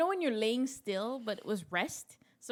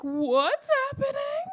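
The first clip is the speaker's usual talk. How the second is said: high, slow panicked cry; 7 dB louder, slower than usual. Retold or imitated. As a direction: squeal it in shock. Water breaks in bathroom.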